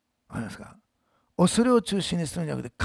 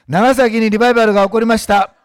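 loud declaration; 4 semitones higher, 11 dB louder than usual. Shown as a demonstration. Pastor leading the congregation in a loud declaration. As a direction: shout it out as a proclamation. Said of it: "私の後に言ってください。".

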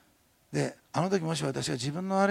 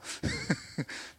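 high laugh; 4 dB quieter than usual. Real, not acted. Laughing at shy Amen.

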